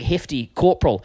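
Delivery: ordinary delivery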